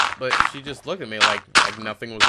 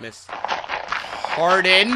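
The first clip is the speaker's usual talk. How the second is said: drawn-out sassy name; 7 semitones higher, much slower than usual. Retold or imitated. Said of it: "why you being a hater".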